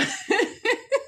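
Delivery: high, sudden giggling laugh; real burst of laughter. Real, not acted. Laughs at Milwaukee joke.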